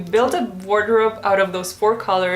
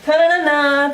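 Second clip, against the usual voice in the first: fanfare reveal; 5 semitones higher, 5 dB louder than usual. Pretend. Showing off her French twist.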